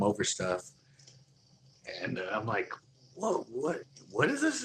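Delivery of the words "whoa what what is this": high-pitched, softer mock astonishment; replayed wide-eyed surprise; retold bewilderment. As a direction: act out a baffled reaction, pitch shooting up. Retold astonishment at hidden room.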